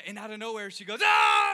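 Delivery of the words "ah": very loud, full-out yell; battle scream. Retold or imitated. Little daughter charging into the bedroom, battle scream. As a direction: explode into a sudden roaring scream.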